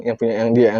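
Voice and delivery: ordinary delivery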